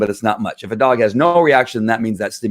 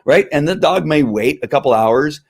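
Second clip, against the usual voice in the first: normal talking voice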